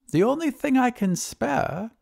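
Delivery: high-voiced wizard thinking aloud; high, thoughtful reply. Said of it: "replied the wizard thoughtfully".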